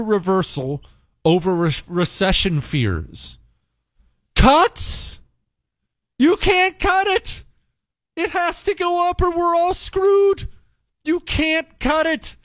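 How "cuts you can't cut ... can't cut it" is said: incredulous outrage. Acted. Outraged reaction to headline.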